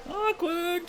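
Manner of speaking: mock awkward. Real, not acted. Echoing the joke to chat, a high sing-song awkward. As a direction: repeat the high teasing awkward. Repeated reaction.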